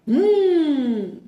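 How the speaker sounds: loud, high revving-engine hum; imitated engine roar. Shown as a demonstration. Imitating the car's engine noise to viewers, loud and high. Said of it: "fez um barulho dele aqui, ó".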